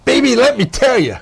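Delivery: hyped-up wrestling manager voice; Jimmy Hart voice. Retold or imitated. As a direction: play it like a showy wrestling manager. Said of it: "in my best Jimmy Hart vo-, voice".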